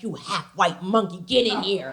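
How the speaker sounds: low, mock-scolding mom voice; mad mother. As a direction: call the kid in, low and cross. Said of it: "She'd be like".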